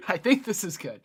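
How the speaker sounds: a happy, emphatic repeat; pleased, emphatic. Real, not acted. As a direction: really sell that it works. Repeats approval after a laugh.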